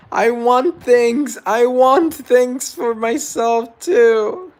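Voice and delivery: loud, high, slow whining; drawn-out comic whine, high and loud; mock whiny outburst. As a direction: ham up the envy like a big wail. Whiny envious outburst, jokingly.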